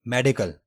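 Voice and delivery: मेडिकल as "meh-de-kal", mɛɖekəl; first syllable मेडे, not मेडी; correct pronunciation. Shown as a demonstration. Teacher models correct मेडिकल for students. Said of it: "मेडे पढ़ना है".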